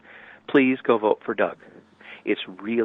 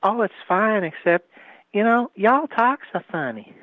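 put-on Tennessee accent; 10 semitones higher than usual. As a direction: imitate the Tennessee gal, pitched way up. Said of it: "And she said".

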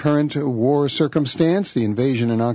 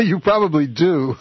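chuckled reply, pitched up; amused laughing; 5 semitones higher, 3 dB louder than usual. Real, not acted. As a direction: laugh as you agree. Host amused by guest's joke.

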